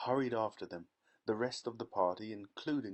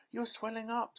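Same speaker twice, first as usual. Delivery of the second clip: much higher frightened scream; 12 semitones higher than usual. A narrator's reading. Mother watching daughter swell, scream. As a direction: cry out in horror. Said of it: "screamed Missus Beauregard again".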